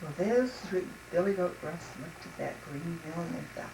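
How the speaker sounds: plain reading voice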